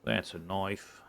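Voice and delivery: slow, hammed-up Aussie movie hero quote; Australian accent. Gamer quoting the famous knife line, mock Australian accent. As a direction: drawl it like a bush hunter showing off his blade. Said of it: "meant to be an Australian accident? Or accent?".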